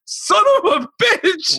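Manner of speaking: high, slow cry of outrage; indignant disbelief. Retold or imitated. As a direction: drag it out high in mock outrage. Acts out reaction to betrayal.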